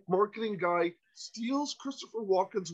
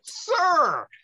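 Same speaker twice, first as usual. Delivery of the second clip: high, louder exasperated sir; exasperated call; 9 semitones higher, 5 dB louder than usual. Retold or imitated. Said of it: "I'm like".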